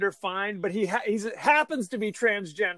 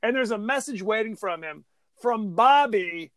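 normal talking voice

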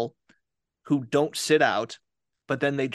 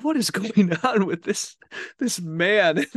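higher-pitched, half-laughing mock bewilderment; amused disbelief; 6 semitones higher, 3 dB louder than usual. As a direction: react in baffled amusement, pitched up. Retold amused disbelief at Danny.

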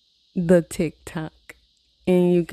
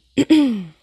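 a sharp, loud throat clear; 6 semitones higher, 7 dB louder than usual. Real, not acted. Vlogger clearing her throat after losing breath. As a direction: clear the throat hard before going on. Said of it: "Speaking too fast, losing breath".

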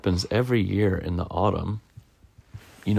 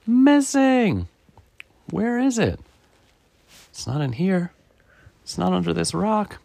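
high-pitched, worried storybook squirrel; fretful Squirrel; 10 semitones higher, 4 dB louder than usual. Acted. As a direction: search in a high, anxious voice. Squirrel frantically searches for leaf.

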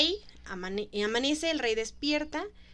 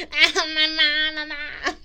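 put-on whiny child wailing, louder and higher; mock tantrum crying; 4 semitones higher, 7 dB louder, much slower than usual. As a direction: wail like a five-year-old throwing a tantrum. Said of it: "Son en el piso así de". (berrinche llorón de niño)

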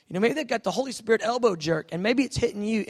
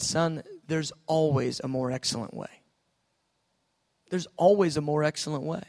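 gentle fatherly; 5 semitones lower, 4 dB quieter than usual. Retold or imitated. Voice of God, gentle.

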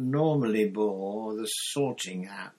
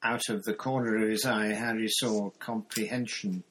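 ordinary delivery, nothing marked

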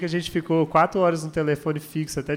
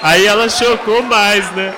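higher, louder, slow exclamation; loud excited telling; 3 semitones higher, 9 dB louder, slower than usual. Real, not acted. Husband telling friends she was shocked, loudly. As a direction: shout it out amused.

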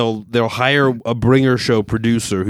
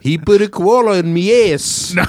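hammed-up Australian complaint; Australian accent; 6 semitones higher than usual. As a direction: protest in a broad Australian accent. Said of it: "take anyone with an Australian accent".

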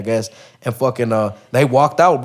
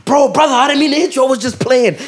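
much higher, louder whining excuse; mock whiny excuse; 12 semitones higher, 4 dB louder than usual. Mocking the attacker's excuse while being chased, high whine. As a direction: whine it like a scared coward.

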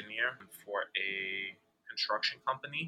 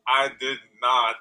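normal talking voice

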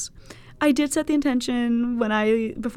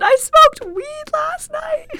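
slow, loud, high-pitched wail; aghast squeal, half laughing; retold shocked outburst; 8 dB louder, slower than usual. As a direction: cry it out in stretched disbelief. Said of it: "I said".

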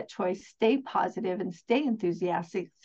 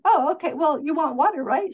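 bright realization; 6 semitones higher, 5 dB louder than usual. Retold or imitated. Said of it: "It's like".

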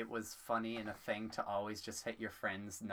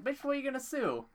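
very high, loud incredulous comeback; high-pitched comic challenge; mock retort; 6 dB louder than usual. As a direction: squeal it back in mock disbelief. Acted retort to angry dad.

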